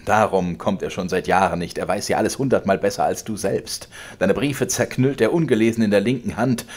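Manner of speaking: laut drohender alter Vater, höher und lauter; zorniger Vater. Acted. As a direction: schleudere ihm die Worte herrisch entgegen. Vater triumphiert. (der Vater droht dem Sohn, laut und herrisch)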